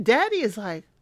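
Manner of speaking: ordinary talk, nothing marked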